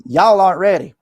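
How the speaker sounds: loud country Southern "Y'all aren't ready"; country twang. Retold or imitated. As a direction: say it big and country like a preacher from the South. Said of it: "As country as I am".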